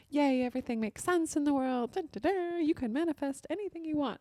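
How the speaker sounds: high, bubbly, hammed-up podcast host; stage-persona cheer. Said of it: "the stage presence, Brittany".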